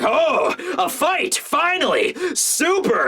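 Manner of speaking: high, eager whoop; thrilled exclamation. Acted. Frankie seeing a good brawl start, thrilled high cry. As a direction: crow with delight at a brawl at last.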